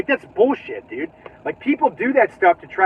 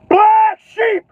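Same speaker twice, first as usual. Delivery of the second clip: a sudden high burst, like a cheer; shouted exclamation; 14 semitones higher, 7 dB louder, slower than usual. Real, not acted. Vlogger bursting out to viewers with a loud high shout.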